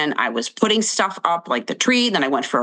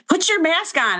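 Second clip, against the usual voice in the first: bossy clerk; 11 semitones higher than usual. Retold or imitated. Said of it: "the woman was like".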